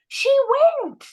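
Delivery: high, loud cry of triumph; excited group cheer. Retold or imitated. Russian learners cheer their classmate getting past the guard, excited and loud. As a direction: cheer it like the whole group just won.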